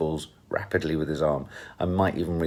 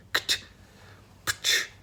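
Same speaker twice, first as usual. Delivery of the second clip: soft clicking tongue pops; imitated pill pops; 5 dB quieter than usual. Daughter imitating the pill noise she heard, soft clicks. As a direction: mimic the click of pill packets. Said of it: "a strange sound of".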